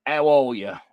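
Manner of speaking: hammed-up Australian greeting to a card; Aussie greeting. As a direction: toss off a cheeky Australian hello. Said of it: "good day".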